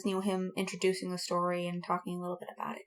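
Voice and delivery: fast whispered aside. Real, not acted. Host whispering a quick aside about the podcast intro. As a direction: rush it under your breath.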